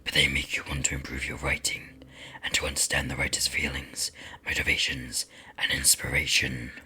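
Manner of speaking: deep raspy demon whisper. Acted. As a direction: hiss it like a lurking demon.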